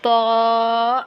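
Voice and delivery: طا held long with an open vowel; drawn-out, oral طا; no nasality. Shown as a demonstration. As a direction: sustain heavy طا without nasal sound. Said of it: "ناک میں نہیں جانا چاہیے".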